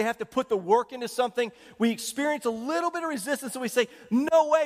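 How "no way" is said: high, louder exclaimed refusal; mock refusal. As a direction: balk loudly at the first resistance. Voicing refusal at first resistance.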